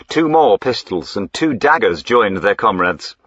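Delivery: ordinary delivery, nothing marked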